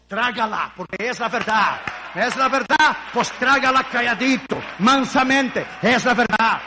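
loud preaching insistence; forceful insistence. Acted. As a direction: press the truth home hard.

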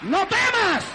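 prophetic cry building to a high shout; prophesying. Retold or imitated. Sister prophesying to God's frightened servant, building prophetic cry. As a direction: proclaim it, stretching the last words long. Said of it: "sale corriendo en profecía".

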